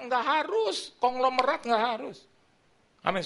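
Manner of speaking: ordinary delivery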